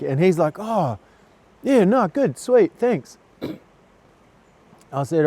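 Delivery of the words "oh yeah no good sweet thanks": higher, breezy easygoing reply; chirpy no-worries mate voice; cheery bloke. Retold or imitated. Amputee stranger answering in the queue, cheery and breezy.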